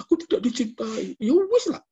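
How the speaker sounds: high mock whining lament; mock sobbing wife. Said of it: "Sambil nangis-nangis". (istri meratap sambil pura-pura menangis, suara tinggi)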